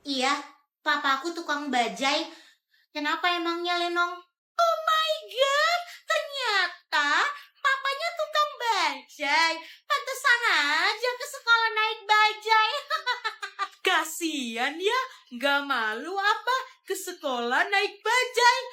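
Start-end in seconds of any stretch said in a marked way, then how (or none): 4.6-12.7 high, slow, hammed-up fake shock; mocking gasp. Bully mocks rival's father. 12.8-13.8 mocking laugh. Bully laughs at rival. 13.9-18.6 mock pity. Bully taunts rival.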